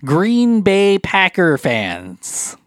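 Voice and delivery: put-on slow, drawn-out Wisconsin accent; mock Wisconsin local, vowels dragged out. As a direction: playfully stretch it in a Wisconsin accent. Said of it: "a lot of accents coming from you".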